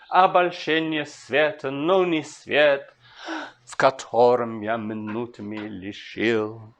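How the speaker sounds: slow, measured reading that leans on each stressed beat; slow Russian verse recital; slow poetry recitation. Shown as a demonstration. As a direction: read the verse slowly, marking the rhythm.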